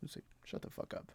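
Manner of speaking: under the breath, voiceless; whispered aside. Real, not acted. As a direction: whisper it to yourself, fed up. Muttered aside to annoying fans.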